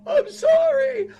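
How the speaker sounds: put-on high tearful child's voice, sobbing out an apology; crying son. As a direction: play the little boy, sorry and crying. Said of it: "He's like".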